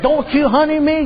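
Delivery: high, snappy mock wife; sassy wife. Wife snapping at husband who called her honey, mock sassy. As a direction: snap it back at him, sassy.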